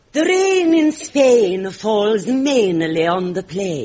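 slow, careful "The rain in Spain" with open vowels; elocution exercise. Shown as a demonstration. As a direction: recite it like a diction lesson. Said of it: "keep your vowels open".

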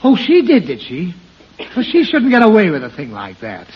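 put-on father bristling on his child's behalf; indignant.

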